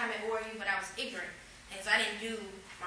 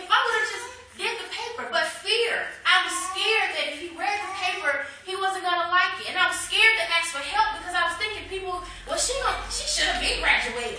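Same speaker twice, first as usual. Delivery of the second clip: high, loud testifying cadence; preaching at full force; 8 semitones higher, 10 dB louder than usual. Acted. To the congregation, confessing her fear, preaching loud. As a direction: testify to the church with rising fervor.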